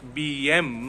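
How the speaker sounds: ordinary delivery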